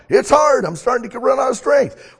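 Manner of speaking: high, loud strained runner; straining runner. Acted. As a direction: gasp it out, near quitting. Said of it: "as that runner wants to quit".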